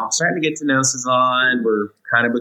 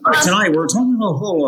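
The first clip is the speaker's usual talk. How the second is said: fast, high newscaster intro; anchor voice; 8 semitones higher, faster than usual. Retold or imitated. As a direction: announce it like a TV anchor. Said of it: "doing our intros for the news".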